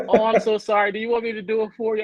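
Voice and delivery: high sympathetic woman's voice; lightly voiced apologetic lady; lady's voice. Worker voiced in story.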